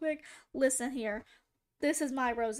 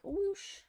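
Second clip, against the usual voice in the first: flapping whoosh; 7 semitones higher than usual. Shown as a demonstration. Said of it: "Shouldn't you be like".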